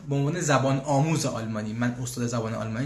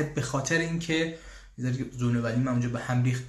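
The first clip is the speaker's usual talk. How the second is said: usual speaking voice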